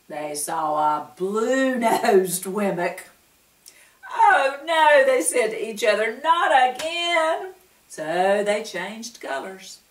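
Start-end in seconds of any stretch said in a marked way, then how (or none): 4.1-5.0 high, loud groaning oh no; dismayed cry. A narrator's reading. Friends seeing a blue nose, high loud dismay. 6.2-7.5 weary despair. A narrator's reading. Dismayed at blue nose.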